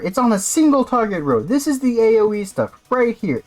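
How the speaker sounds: high-pitched, indignant protest; exasperated rant. Real, not acted. Player arguing with designers over another AOE pick, exasperated rant. Ranting at the misplaced ability.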